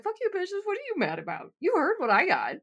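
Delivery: high, sharp mock outrage at the friends; snapping back at the bitches; mock angry retort. To her sleepover friends, a high indignant retort. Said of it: "I'm like".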